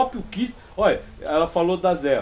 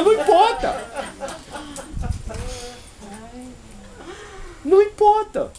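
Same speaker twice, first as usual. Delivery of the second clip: emphatic dismissal; 8 semitones higher than usual. Real, not acted. Telling a client the method is irrelevant, emphatic dismissal.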